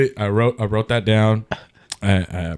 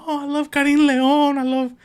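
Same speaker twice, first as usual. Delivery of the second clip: put-on much higher, louder gushing girl voice; mock girl fan; 4 dB louder than usual. Guy mimics the girl gushing over Mexican singers, high. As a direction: gush about the singers like her.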